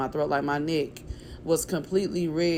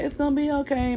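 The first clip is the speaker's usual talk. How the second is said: higher, gentle imitation of a little sister; comforting baby sister; 8 semitones higher than usual. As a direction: soothe her like the kid sister would. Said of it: "my sister was like".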